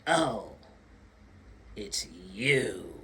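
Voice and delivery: slow, low, dismissive "Oh, it's you"; slow cold disdain. Retold or imitated. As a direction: drag it out, unimpressed. Retelling cold greeting.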